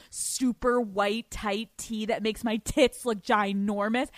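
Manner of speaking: ordinary talk, nothing marked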